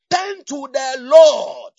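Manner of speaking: loud, very slow drawn-out command; loud exhortation. Real, not acted. Preacher urging congregation, loud slow exhortation.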